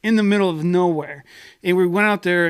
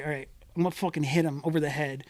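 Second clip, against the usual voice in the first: hushed plan; 9 dB quieter than usual. Retold or imitated. Friends plotting in the back seat, much softer. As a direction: plot it under your breath.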